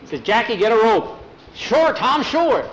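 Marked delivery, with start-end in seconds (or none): 0.2-1.2 loud, higher-pitched order; urgent call. A narrator's reading. Tom pinning gunman, calls for help. 1.5-2.7 high, loud, excited agreement; eager reply. A narrator's reading. Jackie answers urgent order.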